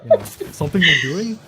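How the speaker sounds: high, laughing Warcraft peasant; lightly squeaky game peasant worker. Retold or imitated. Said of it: "That's a good peasant voice".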